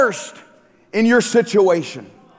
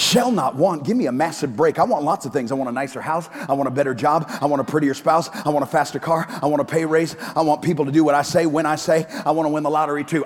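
griping complainer's voice, pitched lower; whiny pessimist; 7 semitones lower, 3 dB quieter, faster than usual. Acted. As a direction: grumble through every wish like a chronic whiner. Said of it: "pessimist commentary on Psalm twenty-three by I'm a Whiner".

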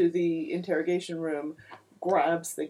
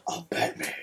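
whispered Batman line; 3 semitones lower than usual. Retold or imitated. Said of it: "they're all like".

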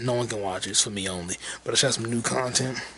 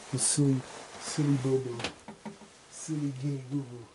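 ordinary talk

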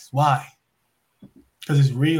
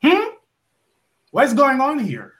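higher-pitched imitated demand; confronting the boss; 5 semitones higher than usual. Wrestler confronting the boss in his office, imitated demand. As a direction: act out challenging someone in charge. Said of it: "I knock on the boss office like".

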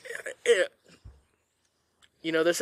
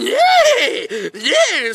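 high loud mock hooray; sarcastic cheer; 12 semitones higher, 6 dB louder than usual. Real, not acted. Teller mock-celebrating the horse going wild again, sarcastic high cheer. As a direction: fake a cheer for the disaster.

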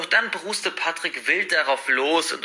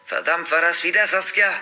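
higher, alarmed question; uneasy; 5 semitones higher, 3 dB louder than usual. A narrator's reading. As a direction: react to a second scream. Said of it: "fragt Leo unruhig". (unruhig, alarmiert)

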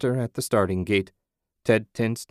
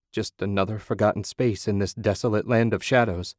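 ordinary delivery

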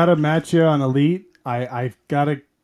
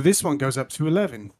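bad [name] accent. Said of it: "we're all doing really bad [name] accents".

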